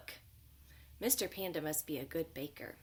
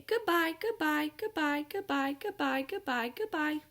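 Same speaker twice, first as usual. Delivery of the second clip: high, sing-song chorus of goodbyes; little bunnies bidding farewell in a high chant; bunnies saying goodbye; 8 semitones higher than usual. Acted. The bunnies leave the panda, high sing-song goodbyes.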